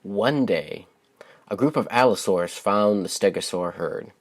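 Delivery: gentle, expressive storybook narration; lilting read-aloud voice for young listeners; reading to children. Acted. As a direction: read it like a bedtime picture book for kids. Reads picture book aloud.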